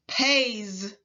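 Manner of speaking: "pays." ending in a voiced z; teacher stretching the final z; final buzz. Shown as a demonstration. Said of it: "Repeat after me".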